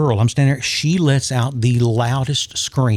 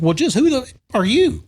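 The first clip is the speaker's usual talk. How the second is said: much higher, affronted who-are-you; mock huffy outrage, pitched way up; indignant challenge; 11 semitones higher than usual. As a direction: bristle and demand his name. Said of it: "And she's like".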